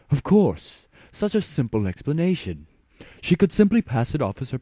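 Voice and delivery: light up with sudden relief; excited realization. A narrator's reading.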